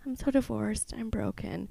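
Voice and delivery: small, pitiful mock lament; mock heartbroken. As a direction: imitate a defeated, broken divorcee. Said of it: "a lot of people are like".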